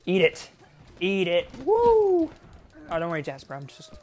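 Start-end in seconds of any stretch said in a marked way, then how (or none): 0.1-1.5 loud, punched taunt; loud gamer's gloating yell; excited screaming. Real, not acted. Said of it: "why I'm screaming like an idiot". 1.6-2.3 very high, loud "woo"; piercing victory whoop; screamed whoop. Real, not acted. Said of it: "why I'm screaming like an idiot".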